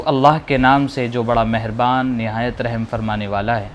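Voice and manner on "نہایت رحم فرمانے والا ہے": ordinary delivery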